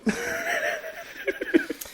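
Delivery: a bright high burst of laughter; high giggle. Real, not acted. Host giggling about the big party, high laugh.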